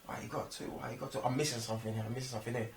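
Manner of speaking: quiet confused self-questioning; muttering to himself. Retold or imitated. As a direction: mutter it under your breath, confused. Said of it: "I was questioning myself".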